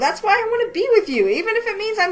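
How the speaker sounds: high, loud burst of yes and I like you; excited declaration. Acted. Nicole shouting her confession back at Darren, high and loud. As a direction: blurt it out high and eager.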